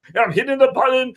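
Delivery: usual speaking voice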